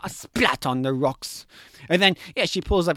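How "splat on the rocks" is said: deadpan drawn-out relish; slow, savoured. Real, not acted. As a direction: draw it out slowly and low. Relishing the fall.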